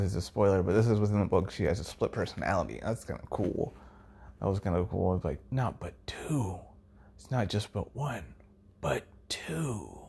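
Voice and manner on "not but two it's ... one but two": eerie whisper. Retold or imitated. Quoting a split-personality character.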